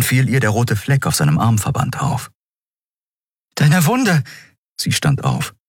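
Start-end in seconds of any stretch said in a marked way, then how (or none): 3.5-4.2 high, sudden alarmed exclamation; erschrockener Ausruf. Acted. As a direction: blurt it out in concern, pitch jumping. She notices his bleeding wound. (erschrocken und hoch ausgerufen)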